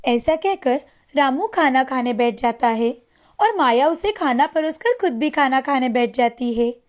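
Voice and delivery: ordinary delivery, nothing marked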